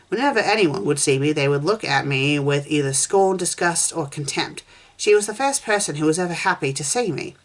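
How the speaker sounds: ordinary delivery, nothing marked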